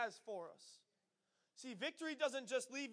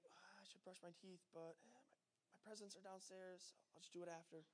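hushed, offhand aside to oneself; quiet inner thought; 2 semitones lower, 17 dB quieter than usual. Acted. Kid talking himself out of brushing his teeth, quiet offhand mumble. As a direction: think it under your breath, barely voiced. Child's sleepy thought, hurrying to presents.

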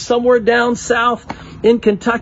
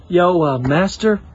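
lower, laid-back understatement; mock casual; 5 semitones lower than usual. Disciples calmly mention the storm, mock casual. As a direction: shrug it off like nothing's wrong. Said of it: "They weren't going".

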